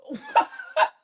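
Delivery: very high-pitched cackle; real helpless high laugh; burst of laughter. Real, not acted. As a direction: crack up laughing at yourself. Laughing at own heartbreak.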